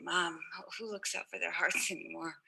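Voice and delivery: low and soft, laughing through the words; soft rueful laugh. Retold or imitated. Daughter answering her mom, soft laughing reply. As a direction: mutter it to mom with a helpless laugh.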